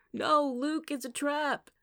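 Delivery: high, loud mock-dramatic announcement; trumpeting warning cry. A narrator's reading. Noah recalls his last PA warning to Kate, trumpeting. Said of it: "Noah trumpeted".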